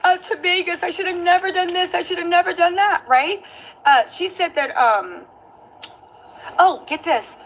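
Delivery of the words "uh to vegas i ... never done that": high pleading sorry; mock tearful pleading; pleading apology. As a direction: beg forgiveness, pitched up. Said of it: "she kept saying".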